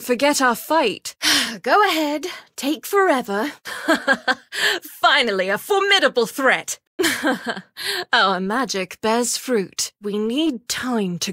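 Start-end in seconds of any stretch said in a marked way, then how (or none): 3.6-4.8 light rising chuckle; amused laugh. Acted. Elf warden chuckling at a slow opponent. Battle bark, amused at foe. 7.0-8.1 pleased laugh. Acted. Elf warden laughing at finally finding a worthy threat.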